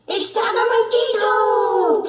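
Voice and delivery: high, loud slow cheer. Acted. Friends cheering the tug of war. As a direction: cheer the match on. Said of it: "cheered the Chimichangos".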